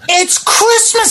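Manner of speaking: high, slow put-on voice of an indignant patron; high, slow mocking patron voice; mock outraged subscriber. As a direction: play the clueless subscriber, drawn out and high. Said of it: "she said".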